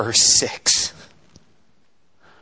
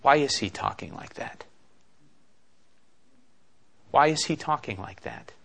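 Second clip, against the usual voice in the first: hushed inner question; 7 dB quieter than usual. Acted. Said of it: "They ask this question".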